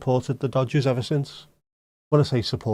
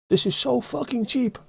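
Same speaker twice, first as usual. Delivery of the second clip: much higher put-on Swedish tourist voice; imitating a Swede; 13 semitones higher than usual. Swedes in a Liverpool pub, delighted high mock Swedish voice. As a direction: squeal it like a delighted Swedish visitor.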